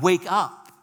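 sudden call to jolt a sleeper awake; rousing call. Acted. Preacher bursts into a cozy room, rousing call.

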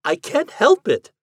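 high, loud, drawn-out protest. Acted. Ghost defends herself to the husband, protesting.

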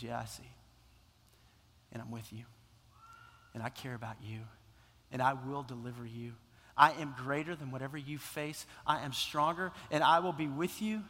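low, soft, reassuring voice of God; God's gentle reply. Acted. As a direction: speak as God comforting a frightened child. Voicing God's calm reply.

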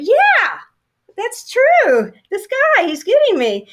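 loud, high delight; gleeful agreement. Retold or imitated. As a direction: burst out thrilled. Gleeful agreement with new boss.

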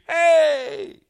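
high, loud, slow; echo calling back. Acted. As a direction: echo the kindness. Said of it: "He hears".

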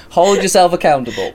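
laughing, high and loud. Real, not acted. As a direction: laugh it out, amused. Laughing at own confession.

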